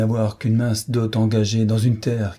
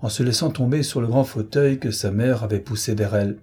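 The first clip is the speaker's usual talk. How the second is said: ordinary delivery, nothing marked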